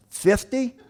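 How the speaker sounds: high, loud rising question; hammed-up tentative answer; questioning guess. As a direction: offer the guess way up high, unsure. Friend relays guess to cop.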